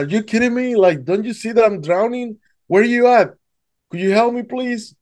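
high, desperate pleading while drowning; frantic plea. Retold or imitated. Drowning swimmer begs the unseen voice for help, frantic.

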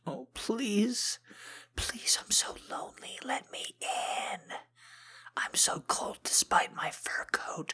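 high whispery whimper of a lonely puppy at the door. Pretend. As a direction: beg to be let in like a cold little dog, high and breathless. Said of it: "raking my paws all over the door".

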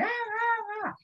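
high, thin honking ah sounds; bell horn noise. Retold or imitated. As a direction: mimic a wheezing old horn. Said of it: "a bell horn going".

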